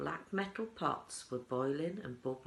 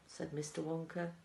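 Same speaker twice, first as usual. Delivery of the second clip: ordinary delivery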